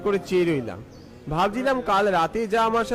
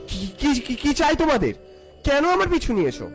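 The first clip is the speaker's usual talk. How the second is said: stammering, higher scared question; trying to sound brave but scared; nervous; 6 semitones higher than usual. Acted. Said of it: "মনে সাহস নিয়ে জিজ্ঞেস করলাম".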